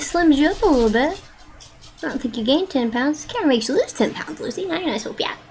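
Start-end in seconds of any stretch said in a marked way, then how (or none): none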